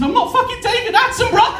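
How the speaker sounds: very high, fast panicked squeal; hysterical protest. Retold or imitated. Panicked guest snaps at receptionist.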